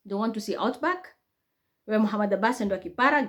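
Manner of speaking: ordinary talk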